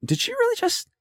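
the moment it sank in; stunned disbelief. Retold or imitated. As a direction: voice jumps up in shock as he recalls it. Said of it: "I'm like".

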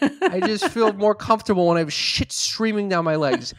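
mock her excuse while cracking up; laughing mock quote. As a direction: absurd mock line through laughter. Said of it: "Some bullshit like that".

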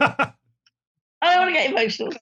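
fighting back real tears; getting emotional. Real, not acted. Director moved by It's a Wonderful Life, close to crying. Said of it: "I'm getting emotional now".

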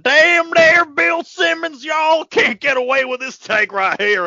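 high, loud hollering Texan fan; over-the-top Texas accent. Retold or imitated. Rockets fan trash-talking a podcaster, hammed-up Texas yell. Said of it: "his impression of Rockets fans".

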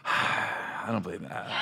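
slow, breathy sigh of disbelief. Shown as a demonstration. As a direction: sigh it out, unconvinced. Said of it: "I'm like this".

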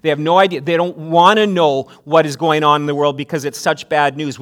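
usual speaking voice

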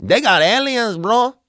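high hyped-up local; high excited local dude. Retold or imitated. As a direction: pitch up like an excited local bro. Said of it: "people were like".

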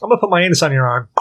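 breezy cat announcing its plan. Retold or imitated. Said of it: "It's like".

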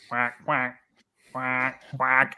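duck quacks in a different voice; quacking. Retold or imitated. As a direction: answer back like a duck, softly. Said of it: "fantastic quacking there by, by you".

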